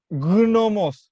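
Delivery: "gnomos" slow, with a heavy sounded G; hard G pronunciation. Shown as a demonstration. Co-host refusing the correction, slow emphatic hard G. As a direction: stubbornly stretch out "gnomos", hitting the hard g. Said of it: "la G es muda".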